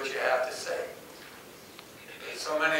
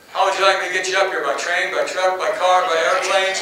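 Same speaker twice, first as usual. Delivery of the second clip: fast, loud and higher, bursting with eagerness; jacked up; 5 semitones higher, 9 dB louder than usual. Retold or imitated. Coach thrilled the star recruit is coming, excited rush. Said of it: "he was so jacked up".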